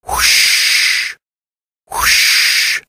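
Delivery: breathy "whoosh" like surf; drawn-out whooshing wave noise; crashing waves. Acted. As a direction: imitate waves rolling in, unvoiced hush. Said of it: "sound of crashing waves".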